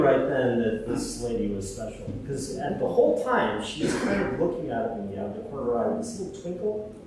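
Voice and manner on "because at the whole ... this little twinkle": normal talking voice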